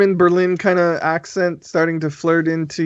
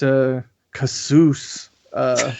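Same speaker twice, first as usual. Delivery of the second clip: ordinary talk, nothing marked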